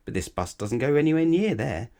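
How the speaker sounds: lightly voiced bystander. Character warns, louder, higher.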